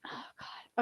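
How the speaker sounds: whispered under breath. Real, not acted. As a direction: breathe it to yourself. Muttering to self while searching.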